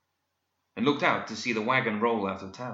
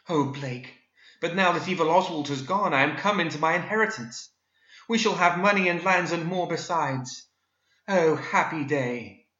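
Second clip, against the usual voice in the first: swooning heroine Daphne; 7 semitones higher, 3 dB louder than usual. A narrator's reading. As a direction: gush like a melodramatic romance heroine.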